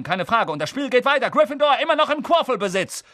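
high, lively match commentary; excited sports commentator. Acted. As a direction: call the game like a radio commentator.

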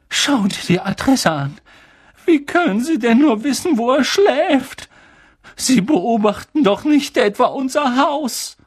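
high, anxious, agitated questions; trembling voice. A narrator's reading. Aunt fears they are being watched, trembling. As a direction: worry aloud, pitched high with fear. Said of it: "mit zitternder Stimme".

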